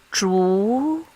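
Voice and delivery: Zhu gliding upward; second tone. Shown as a demonstration. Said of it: "this is how we mark the tones".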